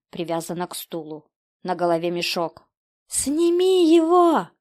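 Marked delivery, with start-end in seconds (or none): 3.1-4.5 high, loud, drawn-out desperate yell; Рябов, shouting. A narrator's reading. Father grabbing a knife to free his child, desperate shout. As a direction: bellow the order in desperation. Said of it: "закричал Рябов".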